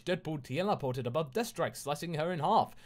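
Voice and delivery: plain reading voice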